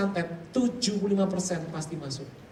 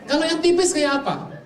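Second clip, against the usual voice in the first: much higher, louder eager question; 10 semitones higher, 6 dB louder than usual. Retold or imitated. Preacher retelling his eager question to the shaman, high and loud. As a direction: ask it keenly, high and loud.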